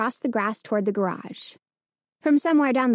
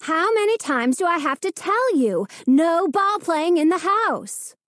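higher, exasperated scolding, slowing on the last words; a mother fed up with ball games at the table; 8 semitones higher, 3 dB louder than usual. Acted. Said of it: "to scold them".

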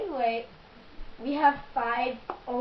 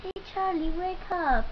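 calling out; 6 semitones higher, 3 dB louder than usual. Real, not acted. Calling someone to wake up.

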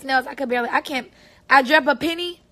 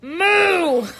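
loud, higher mock moo; cow moo; 5 semitones higher, 5 dB louder than usual. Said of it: "cows don't do that sound".

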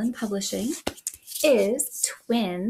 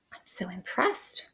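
whisper; 3 semitones lower, 7 dB quieter than usual. Acted. Praising kids' dance moves.

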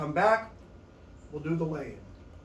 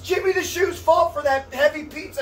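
half-laughing shout; 7 semitones higher than usual. Real, not acted. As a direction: yell the blame through a laugh. Blames pizza, shouting laughing.

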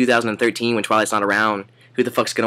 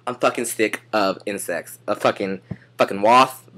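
normal talking voice

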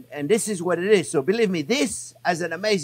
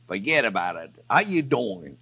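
put-on playful New York accent, pitched down; 6 semitones lower than usual. Cook asking viewers if they like it, mock New York accent. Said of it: "You like my New York accent?".

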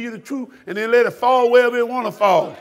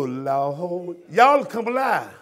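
usual speaking voice

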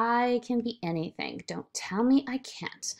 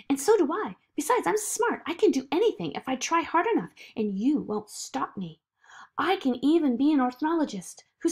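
put-on higher, lively, assertive girl; Zoe, determined child; 6 semitones higher, 5 dB louder than usual. Zoe insisting she has talent, higher and determined. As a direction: stand up for yourself with eager confidence.

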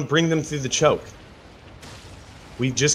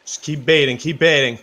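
ordinary talk

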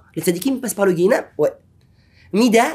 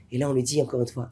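ordinary talk

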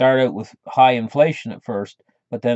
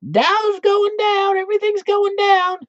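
hammed-up, much higher, louder squeal; mock gloating economists yelling; 8 dB louder than usual. Economists jumping up and down, hammed-up high gloating yell. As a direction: shriek it slowly, gloating. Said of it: "jump up and down a few times and yell".

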